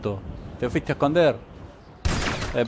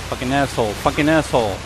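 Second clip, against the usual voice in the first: ordinary talk, nothing marked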